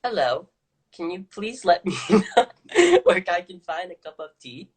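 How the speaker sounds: tentative first go at a British accent. Shown as a demonstration. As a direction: try out a British accent, halfway laughing. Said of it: "Can you please do a British accent?".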